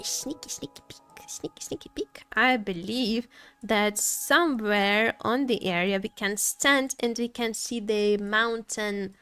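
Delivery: ordinary talk, nothing marked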